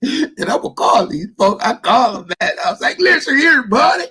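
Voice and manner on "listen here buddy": hammy stage actor. Retold or imitated. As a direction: ham it up like a phone prank. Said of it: "I'm gonna use my best acting voice".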